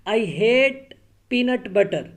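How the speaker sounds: hate read loud and slow; long vowel demo. Shown as a demonstration. Teacher contrasting hate with hat, loud. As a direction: read I hate peanut butter stressing the long a. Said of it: "इसके मुकाबले में अगर हम पढ़ते हैं".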